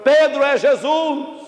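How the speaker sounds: high, long-drawn cry; excited calling out. Acted. John tells Peter who stands ashore, calling out. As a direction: stretch it into a carrying call. John's call to Peter repeated.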